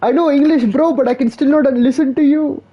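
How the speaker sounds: high-pitched mock Indian accent; mimicked Indian accent. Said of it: "Indians are like".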